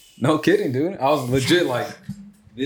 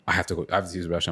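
low, fast, quiet urgent plea; understated urgency, hurried and hushed; serious; 7 semitones lower, 7 dB quieter, much faster than usual. Retold or imitated. Little brother needing the restroom on the walk home, low serious urgency. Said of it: "he looked serious about this one".

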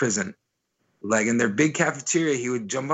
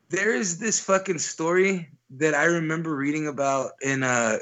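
normal talking voice

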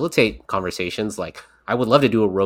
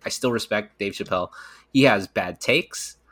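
usual speaking voice

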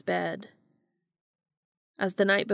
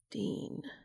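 much softer, gentle plea; softening voice; 2 semitones higher, 9 dB quieter than usual. A narrator's reading. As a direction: soften as you say his name. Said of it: "his voice softening".

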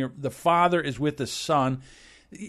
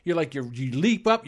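ordinary talk, nothing marked

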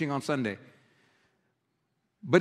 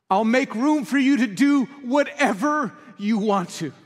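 high, loud, slow proclamation; declared with conviction; 6 semitones higher, 3 dB louder, slower than usual. Shown as a demonstration. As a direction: proclaim the lyric as a full surrender. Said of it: "That's what it means to say".

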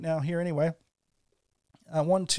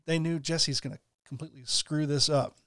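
ordinary talk

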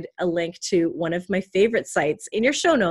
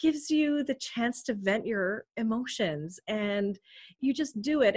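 ordinary talk

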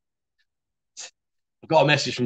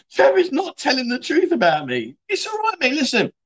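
mock voice of Jimmy; 9 semitones higher than usual. Mocking Jimmy in a put-on voice.

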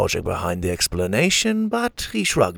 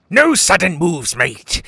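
raised, louder pirate threat; pirate roar; 7 semitones higher, 5 dB louder than usual. Acted. Pirate captain holding a hostage, roared warning. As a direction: roar a warning at a captive's rescuer. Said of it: "he roared".

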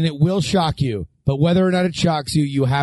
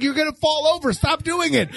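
yelling; 8 semitones higher, faster than usual. Retold or imitated. Yelling at scooter bird.

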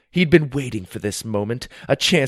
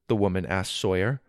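ordinary delivery, nothing marked

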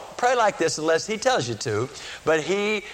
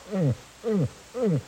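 straining hum; 4 semitones higher, 3 dB quieter than usual. Retold or imitated. Dying man tries to speak.

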